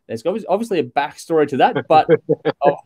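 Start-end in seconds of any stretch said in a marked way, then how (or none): none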